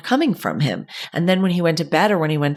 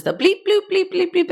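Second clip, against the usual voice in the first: toy beeps; 14 semitones higher than usual. Retold or imitated. Imitating noisy kids' toys.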